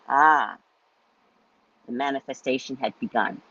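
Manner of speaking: ordinary delivery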